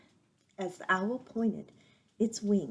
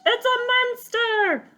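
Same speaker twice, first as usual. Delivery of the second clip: high, loud, slow shrieking owl; screech; 10 dB louder, slower than usual. Acted. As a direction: scream the alarm at the monster.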